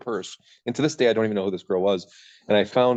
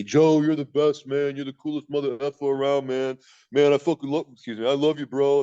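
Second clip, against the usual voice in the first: put-on drunk partygoers; 5 semitones higher than usual. Mocking drunk friends who hug him at the party, higher and slurred. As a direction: lay it on like a sloppy drunk friend.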